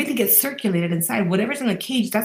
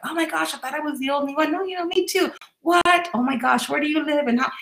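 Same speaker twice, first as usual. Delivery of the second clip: acted-out delight, pitched higher; excited new friends; 6 semitones higher than usual. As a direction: gush like you just found a kindred spirit. Acting out new friends finding each other.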